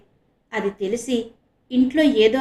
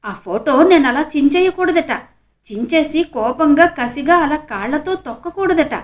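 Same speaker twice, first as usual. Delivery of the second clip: indignant complaint, higher and louder; 6 semitones higher, 6 dB louder than usual. A narrator's reading. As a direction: protest the unfairness with resentment. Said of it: "ఉక్రోషంగా అంది మాధవి".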